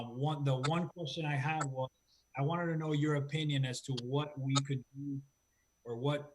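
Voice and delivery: ordinary delivery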